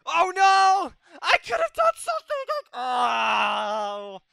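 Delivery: very high, loud wail of despair; anguished shout. Real, not acted. Gamer losing the last round, anguished screaming. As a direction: scream in defeat.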